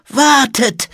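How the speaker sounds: high, loud shaky cry; trembling call. A narrator's reading. Old monk stops departing children, trembling call. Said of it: "rief eine zittrige Stimme".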